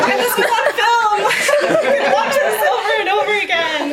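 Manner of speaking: laughing. Real, not acted. Laughing at own embarrassing story.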